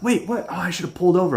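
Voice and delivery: high, flustered voice; mocking Stevie. Stevie caught off guard at the wheel, high flustered. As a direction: blurt it out high and confused. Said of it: "And he's like".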